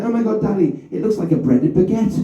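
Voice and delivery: lightly voiced well-spoken kid; middle-class child. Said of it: "One of them went".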